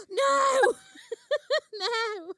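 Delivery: loud, high cry of disbelief, breaking into laughter; shocked cry. Real, not acted. Shocked at stroke remark.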